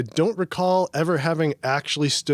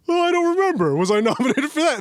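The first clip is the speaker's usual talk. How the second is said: high, loud pompous voice; 13 semitones higher, 4 dB louder than usual. Retold or imitated. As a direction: play it smug and self-important. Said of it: "And then I'm like, "Oh my God, I sound like such a jackass".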